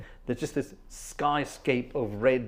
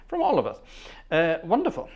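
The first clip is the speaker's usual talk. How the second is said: usual speaking voice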